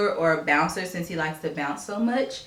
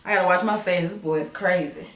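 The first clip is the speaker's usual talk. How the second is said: ordinary delivery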